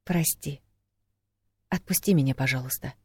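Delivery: soft, subdued plea; meek apology. A narrator's reading. Woman gone limp in his arms, pleading meekly. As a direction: give in and plead quietly. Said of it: "Она всхлипнула и обмякла".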